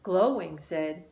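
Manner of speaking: ordinary delivery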